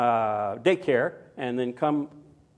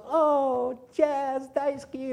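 put-on high, sweet little girl voice; toddler voice; 9 semitones higher, much slower than usual. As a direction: coo like a toddler hugging the dog. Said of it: "and she goes".

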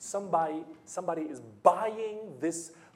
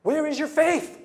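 high, loud sharp rebuke; stern rebuke; 11 semitones higher, 9 dB louder than usual. Acted. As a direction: call them out hard. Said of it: "And Jesus is like".

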